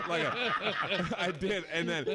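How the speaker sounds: laughing hard; words through laughter. Real, not acted. Cracking up telling story.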